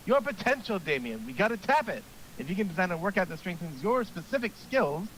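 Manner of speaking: eager gym coach pep talk; hearty coach voice. Acted. Coach voice continues.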